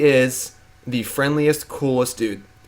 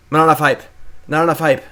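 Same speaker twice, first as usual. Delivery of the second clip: louder hype demand to chat; 3 semitones higher, 6 dB louder than usual. Real, not acted. Streamer scolding chat for weak hype, louder. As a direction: push chat for more energy. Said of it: "demanding more hype from chat".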